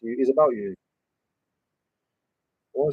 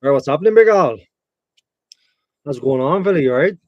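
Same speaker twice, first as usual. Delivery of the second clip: put-on Irish accent; 7 dB louder than usual. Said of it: "I don't know what dialect that is. Something kind of Irish".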